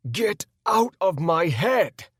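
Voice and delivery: slow, loud, high anguished scream; tortured yell at the throne. A narrator's reading. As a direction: scream it out against the voice in your head. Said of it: "I screamed".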